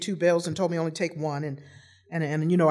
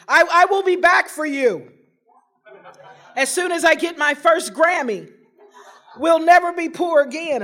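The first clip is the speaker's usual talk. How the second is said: grand promise; 9 semitones higher, 7 dB louder than usual. Retold or imitated. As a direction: promise the family glory, laughing.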